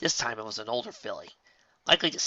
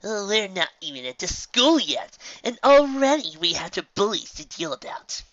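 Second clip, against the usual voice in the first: exasperated filly; 9 semitones higher, 7 dB louder than usual. Acted. Filly complaining about bullies.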